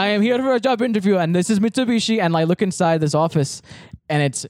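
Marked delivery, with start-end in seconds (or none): 0.0-2.2 put-on higher, earnest Indian-accented voice; imitated Indian accent. Job seeker explaining the interview, imitated Indian accent. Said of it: "I'll do the voice".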